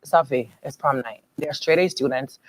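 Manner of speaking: low, soft, slow reasoning; calm her down, slowly and softly; calm husband. Retold or imitated. Husband soothing his furious wife, low soft calm.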